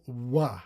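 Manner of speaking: "wa" opening from rounded lips; semivowel. Shown as a demonstration. Teacher demonstrating a semivowel, gliding "wa". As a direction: glide from w into "wa". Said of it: "and a semivowel".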